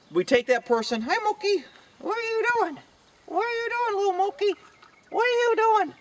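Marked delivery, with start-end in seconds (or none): 1.0-5.9 playful falsetto for an animal; pet talk. Real, not acted. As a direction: coo at a little pet in a squeaky high voice.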